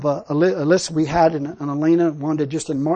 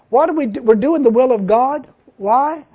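usual speaking voice

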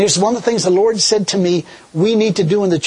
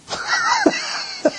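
joyful cackle, thrown up high; high laughing shout; 5 dB quieter than usual. Real, not acted. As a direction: burst into a delighted laugh. Laughing with joy.

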